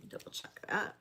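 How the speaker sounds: unvoiced whisper to herself; hushed reminder to self; whispered aside. Real, not acted. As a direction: breathe it as a private note.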